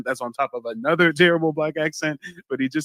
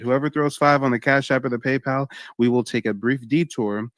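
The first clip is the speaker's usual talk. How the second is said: ordinary talk, nothing marked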